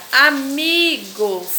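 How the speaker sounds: "amigos" split into syllables, "mi" held long; stress on MI. Shown as a demonstration. Teacher showing pupils how to pronounce, long stressed "mi" in "amigos". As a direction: stretch the "mi" in "amigos". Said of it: "vocês vão pronunciar".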